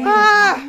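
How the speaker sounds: loud crying cry of grief; anguished wail. Acted. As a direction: let out a sobbing wail. Mother wails.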